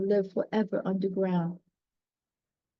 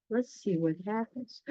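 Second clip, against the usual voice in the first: usual speaking voice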